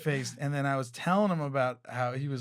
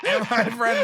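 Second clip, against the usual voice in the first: usual speaking voice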